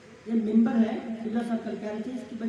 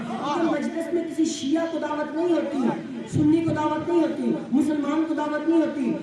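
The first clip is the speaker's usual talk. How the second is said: building to a high, loud rousing climax; fiery preaching; 5 semitones higher, 6 dB louder than usual. Acted. Preacher rousing the whole gathering, loud and high. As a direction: preach it, each line rising in force.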